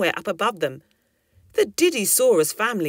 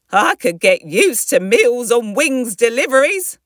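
higher, louder storybook mum; contented mother character; 5 semitones higher, 7 dB louder than usual. Acted. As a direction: play a pleased, jokey mum with a lifted voice. Dora, the mother, speaks.

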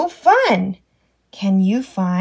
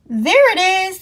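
ordinary delivery

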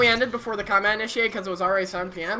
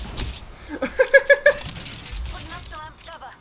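giddy laugh; 9 semitones higher than usual. Real, not acted. Laughs after boasting.

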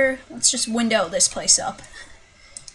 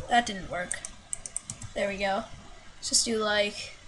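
normal talking voice

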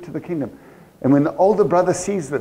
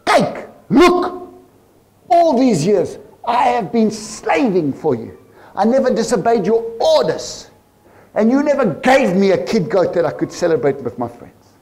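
resentful outburst, louder and higher; angry son; 6 semitones higher, 4 dB louder than usual. Acted. Older brother shouting his resentment at his father, angry. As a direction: throw the grievance at your father. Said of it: "But he answered his father".

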